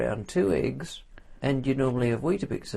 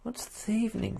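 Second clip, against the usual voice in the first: incredulous squeak; 11 semitones higher, 5 dB quieter than usual. Acted. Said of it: "for God's sake?".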